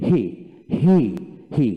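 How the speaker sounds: "হি" with a drawn-out ee vowel; long ee. Shown as a demonstration. Teacher models "হি" for learners with a long ee. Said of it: "ই দিয়ে রস্যি দীর্ঘ ই হয়".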